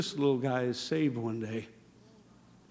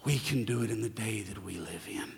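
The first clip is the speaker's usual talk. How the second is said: softer, lower, whispered promise; 8 semitones lower, 10 dB quieter than usual. Acted. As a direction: confide it quietly. Whispered sermon point.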